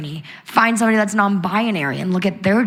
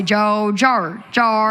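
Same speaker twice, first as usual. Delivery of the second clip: mock Aussie vowels on "Jo, Jar"; Australian accent; much slower than usual. Guest mimics how Australians say their name, mock Australian accent. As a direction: imitate an Australian saying the name, stretched vowel. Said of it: "y'all don't say Jojo. Y'all say".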